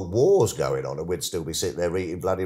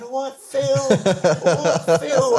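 much higher and louder, a crowd calling out a greeting; cheery fans calling; 7 dB louder, much slower than usual. Retold or imitated. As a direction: shout it like an excited fan in the street. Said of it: "All going".

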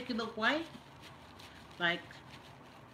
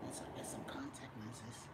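soft unvoiced whisper; 8 semitones lower, 12 dB quieter than usual. Real, not acted. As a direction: drop to a whisper, half to yourself. Whispering aside to camera.

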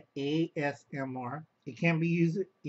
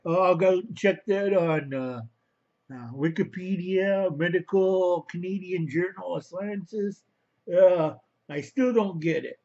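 mocking voice; 4 semitones higher, 4 dB louder than usual. Mocking someone who reads Wikipedia.

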